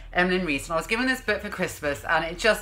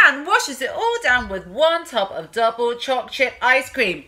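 ordinary delivery, nothing marked

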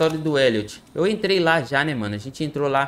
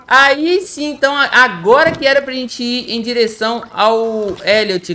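excited; 6 semitones higher, 5 dB louder than usual. Real, not acted. Celebrates progress in game.

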